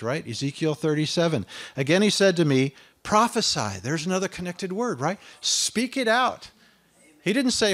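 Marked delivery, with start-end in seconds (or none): 1.7-3.8 loud, high, fervent proclamation; Isaiah crying woe is me, loud; preaching at full force. Acted. 5.4-6.5 high, slow command; commanding call. Acted. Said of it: "commands the listeners".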